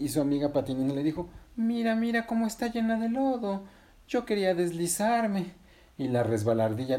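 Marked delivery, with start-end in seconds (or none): 1.6-5.5 put-on high, childlike character voice; high-pitched storybook complaint; disappointed story character. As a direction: lament in a high little voice. Patinina complains about the mud.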